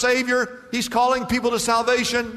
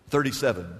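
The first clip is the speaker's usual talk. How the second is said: ordinary talk, nothing marked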